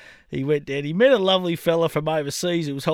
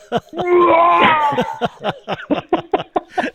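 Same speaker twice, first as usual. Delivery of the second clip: huge, high retching roar; hotel-waking mock spew bellow; imitated spew roar; 12 semitones higher, 7 dB louder than usual. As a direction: roar out a very loud mock vomit. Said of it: "woke up the entire hotel with the".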